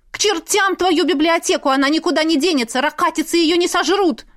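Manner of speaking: high, agitated quarrelling mother; exasperated outburst. A narrator's reading. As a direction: throw it at father in exasperation. Mother explodes in quarrel with father. (возмущённо, высоким голосом)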